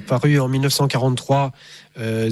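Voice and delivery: usual speaking voice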